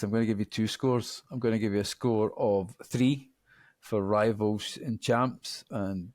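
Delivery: normal talking voice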